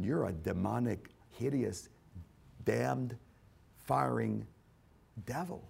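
ordinary talk

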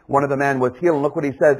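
normal talking voice

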